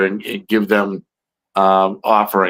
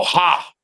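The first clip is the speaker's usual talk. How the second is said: short high "Ha!" called out; imitated call; 7 semitones higher than usual. Telling friends how the creatures call, a short high "Ha!". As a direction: bark out a quick high "Ha!" like a call in the woods. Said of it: "That's how they talk".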